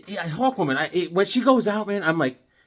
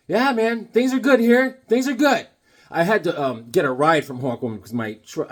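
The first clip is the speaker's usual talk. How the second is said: usual speaking voice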